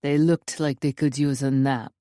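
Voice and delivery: put-on low Russian-accented intruder. A narrator's reading. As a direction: toy with her in a deep Russian accent. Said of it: "The Russian accent".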